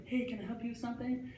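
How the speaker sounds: high, soft clerk voice; light, high polite offer of help; nice store lady. Acted. Nice counter lady greeting a customer, high polite voice. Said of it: "she was like".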